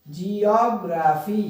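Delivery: Geography slowly split into syllables. Shown as a demonstration. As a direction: break geography into spaced syllables. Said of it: "अलग-अलग syllables को". (Geography को अलग-अलग syllable में, धीरे)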